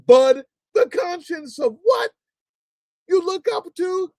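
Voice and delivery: high, loud, shouting in outrage; angry rant. Real, not acted. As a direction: scream at the screen in disbelief. Furious rant at a played speech.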